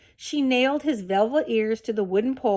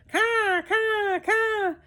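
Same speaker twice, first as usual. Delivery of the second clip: much higher, cawing like a crow; crow caws; 11 semitones higher, much slower than usual. A narrator's reading. Crow cawing in the rabbit's ear, high-pitched. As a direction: screech each caw like a bird. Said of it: "screamed a dark message".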